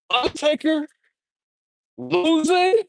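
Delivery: high "Undertaker losing?"; disbelief. Retold or imitated. Streamer recalling disbelief at Undertaker losing, pitched high. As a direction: sound shocked.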